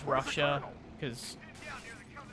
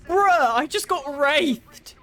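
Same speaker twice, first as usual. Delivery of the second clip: high, loud, drawn-out exclamation; outraged disbelief; 11 semitones higher, 7 dB louder, much slower than usual. Real, not acted. Player just got killed by a wraith, loudly incredulous. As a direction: wail it out, stunned and indignant.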